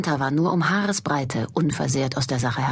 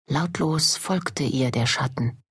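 usual speaking voice

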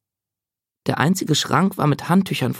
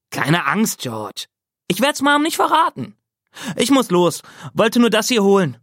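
much higher, breezy brother's voice; easygoing big brother; 8 semitones higher than usual. Acted. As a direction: toss it off kindly on the way out.

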